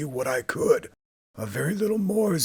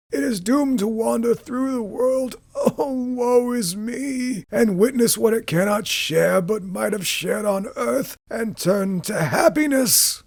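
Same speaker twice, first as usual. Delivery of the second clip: much higher, slow, crying lament; grieving spectre's long wail; wailing ghost; 13 semitones higher, 4 dB louder than usual. Acted. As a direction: wail out woe is me. Ghost wails woe is me.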